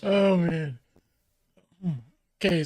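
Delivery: ordinary delivery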